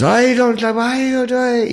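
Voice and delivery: very high, loud goofy imitation; cool dude. Cool dude answering after watermelon hits his foot, high loud mimicry. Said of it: "he's such a cool dude".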